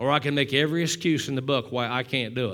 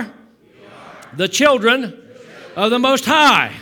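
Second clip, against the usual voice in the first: drawn out, loud and higher; loud slow call; 7 semitones higher, 9 dB louder, slower than usual. Shown as a demonstration. Pastor calling the line out for the church. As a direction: call it out slowly to the room. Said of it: "say it with me".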